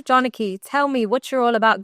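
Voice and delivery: ordinary delivery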